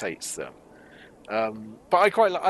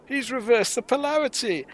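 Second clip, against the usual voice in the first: higher, hammed-up Python-style exclamation; mock-dramatic pythonesque line, pitched up; Monty Python delivery; 10 semitones higher, 3 dB louder than usual. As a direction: overplay it like a Monty Python sketch. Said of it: "it's like a Monty Python delivery".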